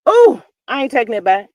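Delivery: usual speaking voice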